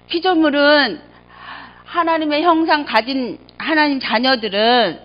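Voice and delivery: loud, pleading sermon climax; fervent preaching. Acted. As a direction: preach it out, urging the flock. Preaching at full force. (힘차게 외치는 설교)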